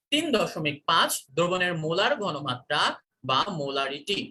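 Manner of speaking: usual speaking voice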